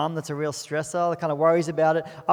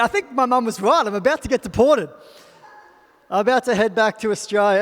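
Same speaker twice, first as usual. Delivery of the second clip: higher, louder panicked inner voice; imitating panic; 4 semitones higher, 6 dB louder than usual. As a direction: replay the moment you thought you were done for. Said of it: "there was a lot of fear".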